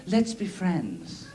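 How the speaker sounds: slow, soft mock tender; soft breakup line. Ex to partner, slow soft mock tender. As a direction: let them down gently. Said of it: "They go".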